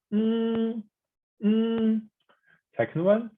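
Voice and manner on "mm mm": "mm" high and perfectly level; flat tone. Shown as a demonstration. Teacher reviewing the first one, flat tone. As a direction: hold "mm" on one high note. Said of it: "flat tone".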